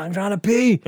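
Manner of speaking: high, acted whiny complaint; indignant protest. Drunk man answers guard tapping him, indignant protest.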